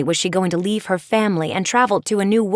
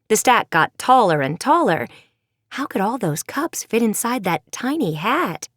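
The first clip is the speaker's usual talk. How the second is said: plain reading voice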